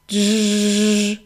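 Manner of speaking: buzzing zzz in a changed voice; a bee's drawn-out buzz. Acted. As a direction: buzz like a bee. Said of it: "продзищала бджола".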